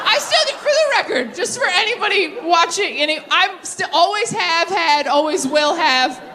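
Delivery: laughing, high-pitched clarification to the audience; laughing insistence. Real, not acted.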